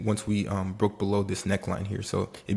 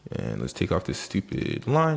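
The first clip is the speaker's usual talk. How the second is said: ordinary delivery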